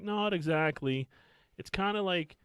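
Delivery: put-on high, slow, hedging girl voice; imitated evasive girls. Girls admitting it is not quite that school, high imitated voice. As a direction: hedge it high and drawn out, imitating them.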